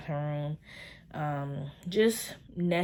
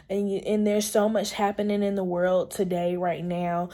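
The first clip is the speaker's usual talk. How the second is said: ordinary talk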